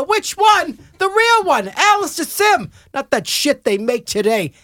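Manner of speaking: high, scornful put-down; indignant uncle. Retold or imitated. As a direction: scoff at the question, outraged. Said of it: "And I go".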